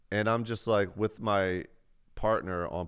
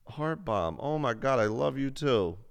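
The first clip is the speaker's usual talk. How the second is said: excited thanks; 6 semitones higher than usual. Real, not acted. Streamer thanking a viewer for a heart bomb, delighted. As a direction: gush back at the chat.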